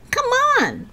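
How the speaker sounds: high-pitched urging call; yelling. Acted. Lisa calls her friends over to march, yelling. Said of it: "Lisa yelled to them".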